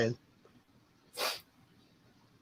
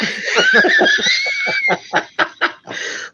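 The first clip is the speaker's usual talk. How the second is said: high burst of laughter. Real, not acted. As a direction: crack up laughing. Laughing at internet joke.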